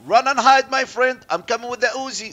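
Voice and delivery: loud, teasing chase call; playful battle taunt. Pretend. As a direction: call out a mock threat as you charge. Taunting enemy while charging.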